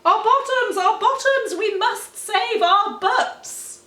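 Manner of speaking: high, loud frantic cry, slowing at the end; loud mock panic; panicked shout. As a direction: wail for the lost bottoms. Clive panics about bottoms.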